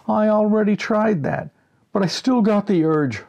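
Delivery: high, loud, plaintive friend on the phone; Reg's voice. Acted. Reg complaining over the phone that the cure didn't work, high and loud. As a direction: whine that the cure failed.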